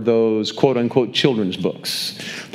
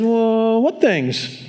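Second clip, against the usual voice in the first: playing along; 9 semitones higher, much slower than usual. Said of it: "Jesus just plays along".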